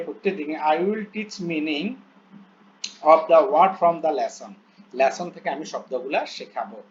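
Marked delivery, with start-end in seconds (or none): 0.6-4.5 very slow, word-by-word English reading; slow dictation reading. Shown as a demonstration. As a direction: read each English word out slowly as if dictating. Reads English plan aloud slowly. (ধীরে ধীরে শ্রুতলিপির মতো পড়া)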